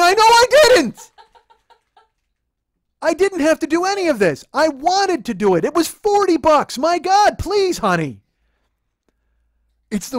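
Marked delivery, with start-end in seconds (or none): 0.0-8.2 high outburst, very loud; exasperated yelling. Retold or imitated. Boyfriend begging his girlfriend to accept his gift, exasperated yell. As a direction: explode on the first phrase, keep shouting, ease on the last. Yells back at girlfriend's protest.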